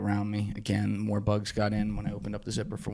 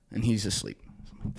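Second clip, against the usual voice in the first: ordinary talk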